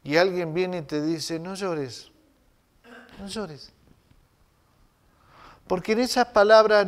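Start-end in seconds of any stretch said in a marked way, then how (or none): 1.4-3.7 gentle, much softer "no llores"; soft comfort. Pretend. Preacher comforts a grieving mother, softly. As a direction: hush a weeping mother tenderly.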